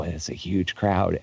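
ordinary talk

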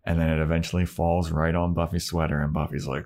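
ordinary talk

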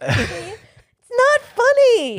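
high, loud, slow mock protest; anger turning to laughter. Real, not acted. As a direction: drag it out, pretending to be annoyed. Annoyed at his OnlyFans joke.

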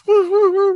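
high, loud woo woo siren; siren imitation. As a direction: wail it like a police siren. Imitating sheriff car sirens.

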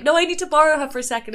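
fast, high, insistent; pushy drunk friend. Retold or imitated. Mimicking a drunk partygoer butting in, fast and high. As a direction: rattle it off, grabbing her away. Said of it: "someone else is being like".

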